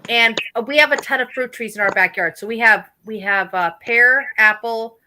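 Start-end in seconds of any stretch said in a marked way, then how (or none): none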